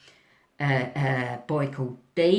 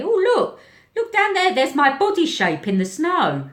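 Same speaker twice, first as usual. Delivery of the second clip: put-on much higher, louder eager kid voice; excited boy; 11 semitones higher, 5 dB louder than usual. Boy showing his teacher his fall mark, excited. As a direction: point it out like a thrilled child.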